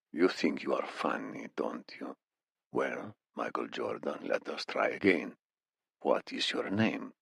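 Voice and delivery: put-on soft, low accented menace; quiet interrogator toying with his prisoner; Iranian captor.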